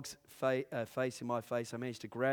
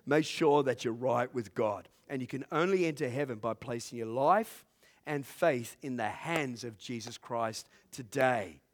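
ordinary delivery

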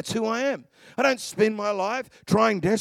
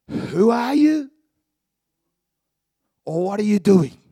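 normal talking voice

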